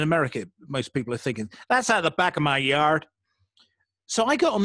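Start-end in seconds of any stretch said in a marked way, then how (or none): 1.7-3.1 a light put-on American accent; half-hearted American yard talk; vague American accent. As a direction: toss it off in a loose American accent. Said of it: "I don't even know what accent that was".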